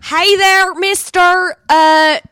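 put-on broad, loud, drawn-out Kansas accent. Dorothy greets the Cowardly Lion, overdone Kansas accent. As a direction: lay the Kansas accent on thick. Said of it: "I can go into my Kansas accent".

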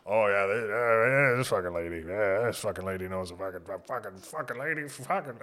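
low, mumbling mock heckler; muttering back-row tenant. Back-row tenants mocking the manager, low and muttered. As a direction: grumble it under your breath from the back. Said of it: "They're like".

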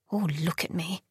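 a princess thinking to herself in self-pity, very softly; inner thought. A narrator's reading. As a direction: say it to yourself. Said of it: "she thought".

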